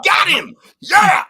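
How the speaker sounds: high, loud victory whoop; excited shout. Real, not acted. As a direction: cheer like you just scored. Cheering a win.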